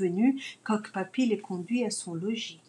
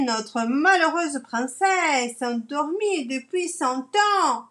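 royal herald; 4 semitones higher than usual. Acted. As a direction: proclaim it to the whole town square. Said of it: "Coq Papy est crieur public".

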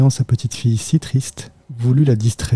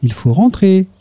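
bright, much higher and happy; little girl waking her dog, joyful and higher; 8 semitones higher, 3 dB louder than usual. A narrator's reading. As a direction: wake him up cheerfully. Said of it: "lui dit-elle joyeusement".

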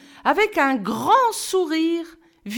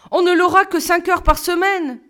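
much higher, louder exclamation; exclaiming; 8 semitones higher, 6 dB louder than usual. A narrator's reading. Camo exclaiming to Lantier, much higher and loud. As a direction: burst out with it. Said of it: "s'exclama Camo".

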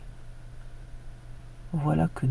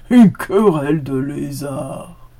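slow, loud mocking exclaim; scoffing ox; 6 dB louder, slower than usual. A narrator's reading. The ox scoffs at the dog's lizard quarrel. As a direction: scoff at the silly request. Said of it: "s'exclaffa le bœuf".

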